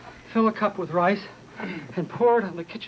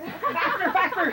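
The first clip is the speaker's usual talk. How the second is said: higher, laughing cheer to go faster; excited urging; 7 semitones higher, slower than usual. Real, not acted. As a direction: egg them on, laughing. Urging group on while exercising.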